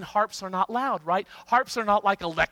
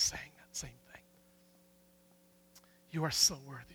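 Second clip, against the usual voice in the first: soft, low, reverent near-whisper; hushed awe; 5 semitones lower, 11 dB quieter than usual. Real, not acted. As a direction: fade into awed prayer. Awed, turning into prayer.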